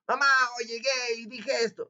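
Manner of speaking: high, fast near-shout; gritando. Shown as a demonstration. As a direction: blurt it out too high and fast, the wrong way. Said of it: "Pero tampoco vas a ir a gritar". (gritarlo agudo y rápido, como mal ejemplo)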